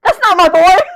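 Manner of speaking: loud, high wail of protest; indignant outcry. Real, not acted. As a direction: cry out in playful outrage. Indignant at character's redesign.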